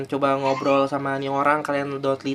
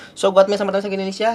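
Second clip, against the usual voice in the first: fast sign-off; 5 semitones higher, 5 dB louder, much faster than usual. Real, not acted.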